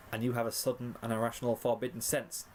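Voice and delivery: usual speaking voice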